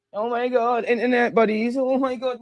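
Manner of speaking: high, cooing fake fan; teasing high-pitched gush; mock fawning fan. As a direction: gush over internet friends in parody. Mocking chat's fawning reply.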